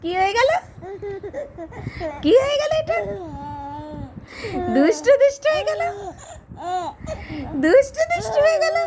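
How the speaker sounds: very high and fast with laughter; high, fast, laughing cooing. Real, not acted. Playing with laughing baby.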